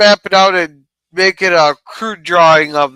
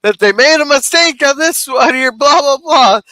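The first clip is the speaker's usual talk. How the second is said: retold grandma yell; 7 semitones higher than usual. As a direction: imitate grandma calling out a mistake. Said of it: "my grandma yelled out the window".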